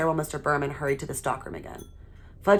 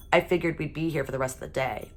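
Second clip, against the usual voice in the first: ordinary delivery, nothing marked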